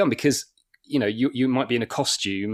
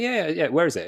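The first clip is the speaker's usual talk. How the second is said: high keen yeahs; brightly keen to fetch it yourself; eager politeness; 8 semitones higher than usual. Retold or imitated. Star asking the runner where the tea is, eagerly.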